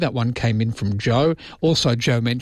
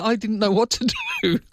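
laughing through the words; giggling, high and shocked; 11 semitones higher than usual. Retold or imitated. Retelling her shocked on-air reaction.

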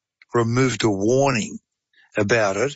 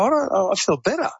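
imitating a patient, voice jumping much higher; lightly acted surprise at feeling better; relieved patient; 8 semitones higher than usual. Patient in wheelchair tells his doctor, a higher relieved voice.

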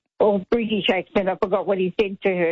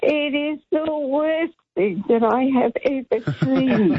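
laughing; 5 semitones higher, slower than usual. Real, not acted. Caller laughing over terrible movie.

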